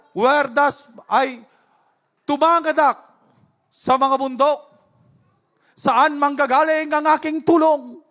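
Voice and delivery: measured, forceful scripture reading; preaching from scripture. Real, not acted. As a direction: declaim the verse in slow, separate phrases. (mabagal at mariing pagbigkas ng talata)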